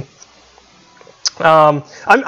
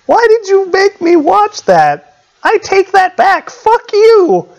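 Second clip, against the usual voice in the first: shocked outrage; 13 semitones higher than usual. Real, not acted. Shocked by a video.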